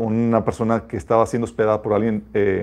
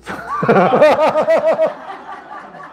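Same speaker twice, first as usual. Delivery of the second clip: a high, amused laugh at himself. Real, not acted. Speaker laughing at his younger self's indignation. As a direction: laugh at your own past silliness.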